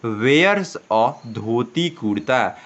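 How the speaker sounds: slow, word by word for learners; slow model reading. Shown as a demonstration. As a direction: read it out slowly like a teacher. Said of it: "क्या है". (धीरे-धीरे पढ़कर सुनाना)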